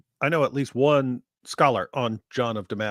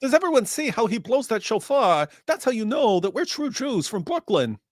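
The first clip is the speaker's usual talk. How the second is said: put-on Yiddish accent; 8 semitones higher than usual.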